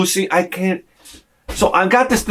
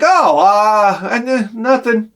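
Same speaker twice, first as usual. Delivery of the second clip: mock flustered denial; 6 semitones higher, slower than usual. Caught by his niece in the costume, flustered higher stammer.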